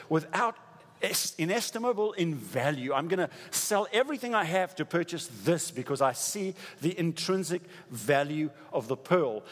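high, driving preacher voice; full-force preaching. Acted. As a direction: preach it, building fervour.

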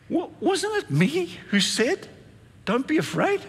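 high-pitched, stammering disbelief; mock bewilderment, pitched right up; puzzled God. God asking the angels, comically puzzled.

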